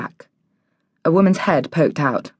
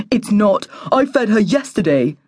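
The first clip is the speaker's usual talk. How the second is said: put-on higher, whiny childish protest; whingeing boy; 4 semitones higher than usual. A narrator's reading. Boy whingeing to his sister that he fed the cat. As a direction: whinge it back in a boy's voice. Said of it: "the boy whinged".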